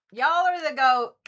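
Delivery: loud, high proclaiming; excited shout. Acted. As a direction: announce it with gusto.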